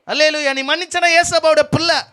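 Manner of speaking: high, loud, stretched-out proclamation; preacher at full cry, slow and ringing; full-force preaching. Real, not acted. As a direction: drive each phrase home to the congregation.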